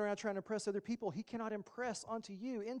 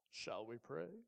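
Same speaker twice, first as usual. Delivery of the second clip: mock pious pastor; 6 semitones lower, 11 dB quieter, slower than usual. Pastor mocking showy prayer.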